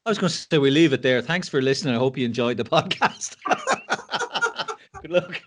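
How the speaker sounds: real helpless laughter through the words; laughing. Real, not acted. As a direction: joke sign-off breaking into laughter. Joking sign-off, cracking up.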